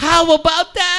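high, loud burst of delight; excited congratulation. Real, not acted. Co-host reacting to news of a friend's new baby, excited.

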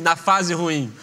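ordinary delivery

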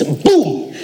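high-pitched mock explosion; sudden boom. Saleh startling the woman beside him, booming. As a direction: burst it out to startle her. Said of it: "looks at her and he goes".

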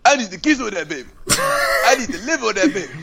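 high-pitched rapper impression; Future. Joking that the yelling friend sounded like a rapper, impression. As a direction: imitate a rapper, voice pitched well up. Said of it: "'Cause he sound like Future".

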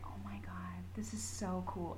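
breathless whispered awe; awed whisper. Retold or imitated. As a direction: whisper it like you can't believe it. Retelling her own awed reaction.

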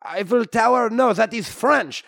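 put-on comic French-Canadian accent; hammy Québécois hero voice. French-Canadian Wolverine character.

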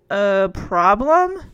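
drawn-out disbelieving echo; slow, questioning repeat. A narrator's reading. Robin hears of a problem on the phone, slowly repeating. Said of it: "Robin repeated slowly".

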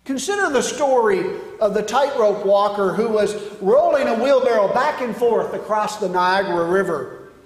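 ordinary talk, nothing marked

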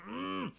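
muffled high hum, mouth closed; strangled closed-mouth mock scream; underwater scream. Shown as a demonstration. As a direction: squeal through shut lips like underwater. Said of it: "that's what it sounds like".

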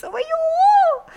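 high, loud gasping oh; squealing gasp of delight; touched gasp. Acted. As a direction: gasp as if moved. Reacting to sweet story.

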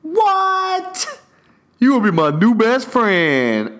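overjoyed at the deal; thrilled outburst; delighted shock. Retold or imitated. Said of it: "And I was like".

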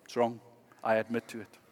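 soft, humble admission; softly spoken model apology; quiet sincere confession. Shown as a demonstration. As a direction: say it gently, owning the wrong. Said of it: "Just say".

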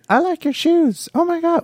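very high-pitched mock student voice; middle school kid. Middle schooler derailing the drum lesson, mocking high kid voice.

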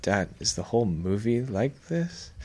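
put-on soft, slow, puzzled kid asking dad; a small bewildered question, drawn out; confused kid. Son asking dad about the movie, softly puzzled. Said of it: "And I'm like".

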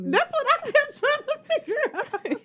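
can barely get words out for laughing; real helpless laughter. Real, not acted. Laughing at friend's joke.

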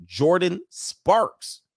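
slow stretched Jordan Sparks; drawn out name. Real, not acted. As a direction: draw out "Jordan Sparks" slowly. Reading name from superchat.

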